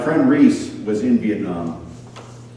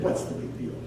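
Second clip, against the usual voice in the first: whispered shrug; 10 dB quieter than usual. Retold or imitated. Tempted person shrugging off sin.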